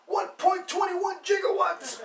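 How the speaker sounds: very high, drawn-out mock-astonished shout; exclaimed film catchphrase. Host quoting a Back to the Future line, high hammed-up shout.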